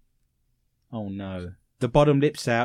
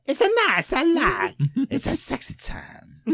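put-on higher, breathy Kazakh accent; Borat impression; 8 semitones higher than usual. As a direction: do a quick Borat. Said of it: "I'm just thinking of Borat".

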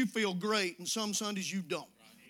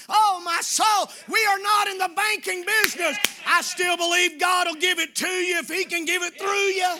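loud, high preacher's shout, slowing on the key words; full-force preaching; 8 semitones higher, 9 dB louder than usual. Real, not acted. Pastor telling his congregation they are the church, full-force preaching. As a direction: thunder it from the pulpit.

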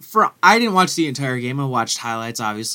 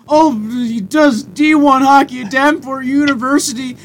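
mock gushing announcer; 9 semitones higher, 5 dB louder, slower than usual. Mocking the first intro.

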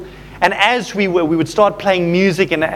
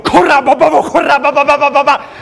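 louder, higher, urgent praying in tongues; higher, louder tongue-speaking with rising authority; tongues stepped up; 7 semitones higher, 4 dB louder, faster than usual. Shown as a demonstration. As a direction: kick the tongues up a notch. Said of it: "my tongues went up a notch".

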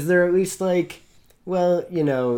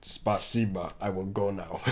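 softer, whispered fake Russian accent; mock Russian; 4 semitones lower, 7 dB quieter than usual. Friend exits the bit whispering in a fake Russian accent. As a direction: sneak off murmuring in fake Russian.

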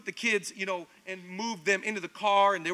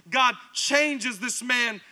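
normal talking voice

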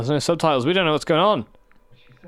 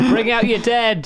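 loud, high hammed-up corpse collector's cry; raised mock town crier call; Monty Python plague cart call; 6 semitones higher, 4 dB louder, slower than usual. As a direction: bellow it like a cart man calling for the dead. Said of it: "So, so Monty Python reference".

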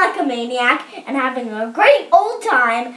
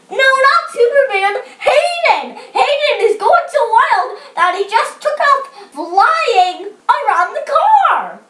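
high, urgent story yell; exasperated boy calling out; 8 semitones higher, 3 dB louder than usual. A narrator's reading. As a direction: yell the correction, pitched well up. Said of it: "yelled Austin".

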